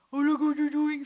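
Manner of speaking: a loud, high exasperated "oh, look what you're doing"; exasperated parent. Retold or imitated. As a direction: scold a messy child, half joking. Parent's many faces to baby.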